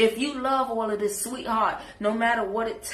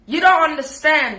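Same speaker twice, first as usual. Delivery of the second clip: sudden loud higher challenge; loud outburst; 7 semitones higher, 11 dB louder than usual. Real, not acted. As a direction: burst out at her. Confronting guest.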